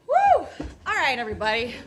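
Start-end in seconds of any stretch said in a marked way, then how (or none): 0.1-0.5 woo. Real, not acted. Whooping at the audience as she takes the stage. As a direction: whoop to the crowd. Crowd greeting.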